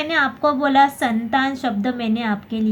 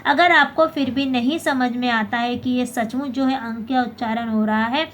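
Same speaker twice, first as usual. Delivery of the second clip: ordinary delivery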